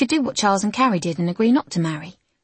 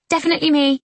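eager; 8 semitones higher, 4 dB louder, faster than usual. Acted. Lizzie's hand shoots up.

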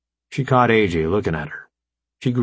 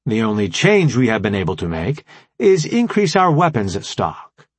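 formal AI commander, raised and emphatic; brief the allies in a higher, deliberate voice; Alpha Prime; 4 semitones higher than usual. Acted. Voices the AI leader explaining.

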